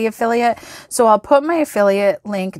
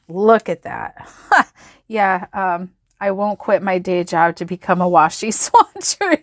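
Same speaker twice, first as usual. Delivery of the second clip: ordinary delivery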